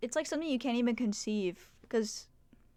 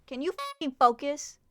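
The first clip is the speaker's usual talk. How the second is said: annoyed, louder snap at the camera; yelling at camera; 4 semitones higher, 5 dB louder, slower than usual. Real, not acted. Streamer yelling at her camera to focus, irritated. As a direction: bark at the camera in frustration. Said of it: "I was yelling at my camera".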